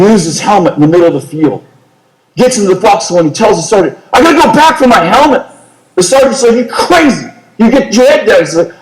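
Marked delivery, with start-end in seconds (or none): 4.1-5.5 high, fast, urgent insistence; urgent plea. Retold or imitated. Soldier pleading under fire. 6.6-8.4 high, sharp battlefield command; sergeant's order. Retold or imitated. Sergeant ordering under fire.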